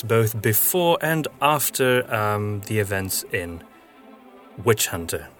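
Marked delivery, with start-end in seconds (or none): none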